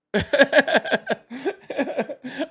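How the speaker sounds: long high-pitched laugh. Real, not acted. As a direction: crack up in a long, high giggle. Host laughing at caller's performance.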